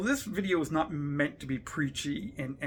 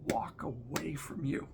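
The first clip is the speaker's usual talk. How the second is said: trailing into a pained whisper; a hurt, breathy fade; 4 dB quieter than usual. Real, not acted. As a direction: let it fall away to a whisper. Pained talk of friends leaving.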